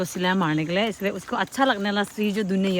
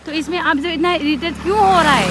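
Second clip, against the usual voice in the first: confronting; 6 semitones higher, 5 dB louder than usual. Retold or imitated. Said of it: "ऐसे बोल के बोला है ना हम उसको".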